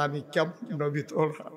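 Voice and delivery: low, soft plaintive chant; subdued lament. Acted. Prophet laments before his companions, quiet sorrowful chant. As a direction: let it sink into quiet sorrow. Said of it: "নবীজি কান্দে".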